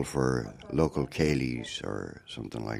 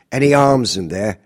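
loud, high Cockney officer's demand; Cockney accent; 9 semitones higher, 12 dB louder than usual. Retold or imitated. Officer searching Granny's wagon, loud Cockney accent. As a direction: bark it out like a London soldier, Cockney. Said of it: "typical Cockney accent".

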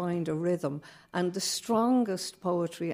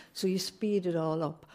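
ordinary delivery

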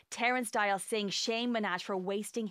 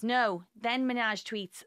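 ordinary talk, nothing marked

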